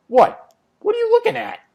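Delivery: hammed-up "what's your problem" squeak; mock puzzled. Villain notices his listener ignoring his speech, mock-puzzled high voice. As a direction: pitch jumps up high.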